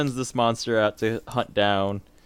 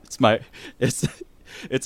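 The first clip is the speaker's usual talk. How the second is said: ordinary delivery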